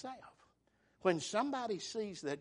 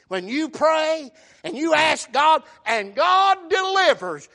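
loud, high pulpit exhortation; full-voiced sermon fervor; fervent preaching; 6 semitones higher, 12 dB louder than usual. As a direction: preach it out with weight on the words.

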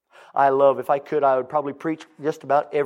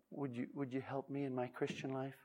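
soft pleading; 13 dB quieter than usual. Acted.

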